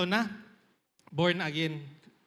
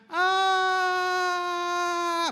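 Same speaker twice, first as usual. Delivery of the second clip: high scream falling, then a thud; scream before the fall; 6 semitones higher than usual. Shown as a demonstration. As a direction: scream the whole way down. (sigaw bago bumagsak)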